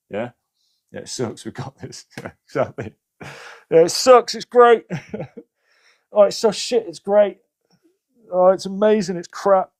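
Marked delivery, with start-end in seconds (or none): 3.7-4.4 high, loud, sing-song sarcasm; mock cheerful. To the viewers, joking about journaling in a mock cheerful squeal. 4.4-9.7 high, loud sing-song irony; mock cheerful. To the viewers, joking that journaling is great and crap, mock cheerful.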